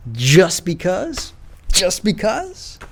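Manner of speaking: slow, mocking drawl on just because. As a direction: sneer the excuse back at them. Mocking repeat of others' excuse.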